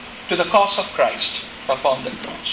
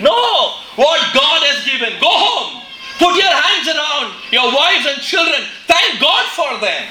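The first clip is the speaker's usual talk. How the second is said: emphatic preaching; 7 semitones higher than usual. Real, not acted. Preacher corrects the grumbler.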